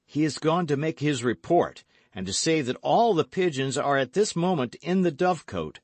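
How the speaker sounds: loud, high, emphatic hero; alarmed young gallant. A narrator's reading. D'Artagnan alarmed.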